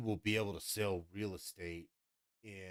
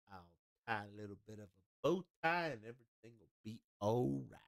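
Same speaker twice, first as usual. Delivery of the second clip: Southern gentleman; 3 dB quieter than usual. Acted. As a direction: soothe a lady like a courtly Southerner. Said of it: "a Southern gentleman".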